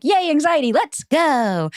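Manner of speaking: high-pitched, hammed-up anxious cheer; mock morning energy. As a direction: act out being wired on waking. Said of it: "I'm more energized in the morning".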